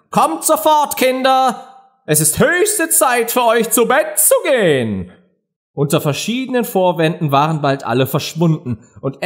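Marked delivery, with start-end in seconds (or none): 0.1-5.1 very high, anxious mother-bird call; trembling voice. A narrator's reading. Canary summons its little ones away, voice trembling. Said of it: "rief mit bebender Stimme seine Kleinen".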